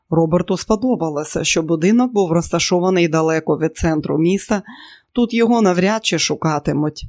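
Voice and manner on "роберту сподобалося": usual speaking voice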